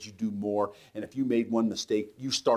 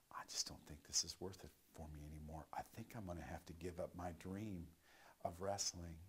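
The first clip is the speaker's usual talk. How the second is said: quiet defeat; 17 dB quieter than usual. Retold or imitated. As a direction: give up almost under your breath. Retells wanting to give up.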